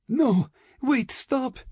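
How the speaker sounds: very high, desperate cry; panicked plea to stop. A narrator's reading. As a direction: cry out in terror, pitch way up. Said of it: "Ilyana cried".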